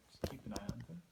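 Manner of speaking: soft unvoiced whisper. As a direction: murmur it so no one overhears. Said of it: "I say very low".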